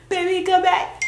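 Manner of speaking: laughing loud plea. Retold or imitated. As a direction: belt it out mockingly while laughing. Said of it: "I said".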